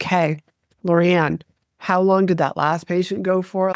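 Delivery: mock disengaged, unanimated question; low-energy delivery. Shown as a demonstration. Showing how a dull doctor would quiz the assistant, low-energy. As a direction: ask it with the energy drained out. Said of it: "just kinda talked like this".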